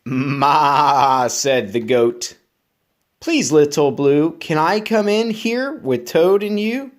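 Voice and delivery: lively, bouncy storybook reading; reading to children. Acted. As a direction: read it up to keep a child listening. Reads picture book aloud.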